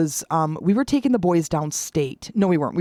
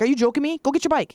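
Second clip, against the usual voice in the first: mom mad; 8 semitones higher, faster than usual. Retold or imitated. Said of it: "she'd be mad".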